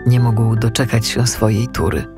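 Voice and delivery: usual speaking voice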